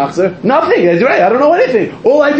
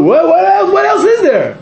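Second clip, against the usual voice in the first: emphatic shout; 11 semitones higher than usual. Real, not acted. Rhetorical peak of sermon.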